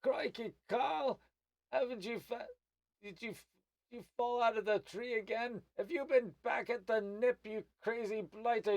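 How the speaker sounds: high, loud gnome scolding his friend; gnome calling out. Acted. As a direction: holler crossly in a high-pitched gnome voice. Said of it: "he's calling out".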